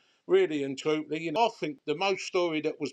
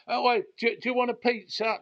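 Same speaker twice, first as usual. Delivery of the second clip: high, loud jeering call across a bar; mock shout from the bar; 11 semitones higher, 3 dB louder than usual. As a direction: imitate punters yelling at him to tease him. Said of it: "they shout out".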